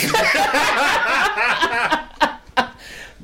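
long burst of laughter; real laughter. Real, not acted. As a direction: laugh at the absurd turn. Laughing at would-be rescuer running off.

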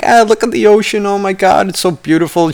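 high-pitched, dreamy gushing; mock blissful awe. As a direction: gush over the view in a hammed-up high voice. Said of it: "peaceful, tranquil, and just being like".

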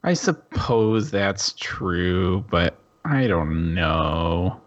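slow, heavy sighing reply; sighing, resigned. Acted. Heartbroken Grizz sighs at his friends, slow and resigned. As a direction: drag it out with a sigh. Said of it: "he sighs again and he says".

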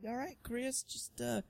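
high, soft mock operator calming Chris; hushed and high like a tech before a needle; mock doctor voice. Host imitating Matrix tech soothing Chris, high and hushed.

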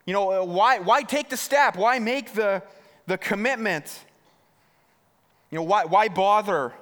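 mock the listener as their nagging doubt; inner voice of doubt. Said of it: "It's like this loud, screaming voice".

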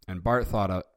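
ordinary delivery

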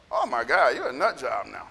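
low-pitched, mildly appalled aside; dismissive. Acted. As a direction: write them off under a low voice. Acts out dismissing drifted people.

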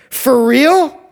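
a hammed-up gasp of doubt; disbelieving. Disbelief at a free diamond.

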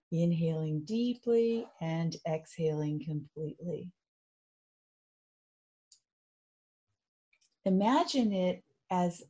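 calm, even, unhurried meditation voice; guided meditation. Real, not acted. Coach guiding listeners through loving kindness, soothing meditation voice. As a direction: gently lead them inward, leaving long pauses.

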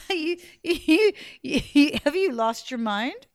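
startled, stammering disbelief; shocked. Acted. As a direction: blurt it out in shock. Young lady shocked at intruder.

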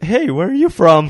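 high, loud mock question; mock asker. Friend imitating someone asking where he's from. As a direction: play the nosy stranger asking. Mocking people asking origin.

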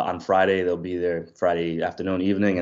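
ordinary delivery

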